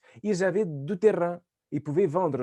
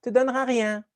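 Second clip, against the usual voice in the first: put-on higher, sing-song lecture to a child; mock parent scolding; 7 semitones higher, 4 dB louder than usual. Friend mimics a parent telling a kid not to lie, higher. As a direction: wag a finger like a preachy parent.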